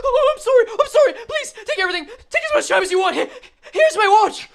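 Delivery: high, loud, gasping panic; acted terror, pitch shooting up; panicked victim. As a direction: beg the mugger in a hammed-up squeal. Voices terrified robbery victim.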